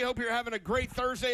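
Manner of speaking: normal talking voice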